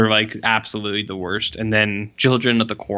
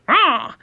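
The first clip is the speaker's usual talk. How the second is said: sudden shrill screech much higher; imitating a bird diving at someone; mock bird screech; 4 dB louder than usual. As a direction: squawk it like a bird lunging at his face. Bird screeches in his face.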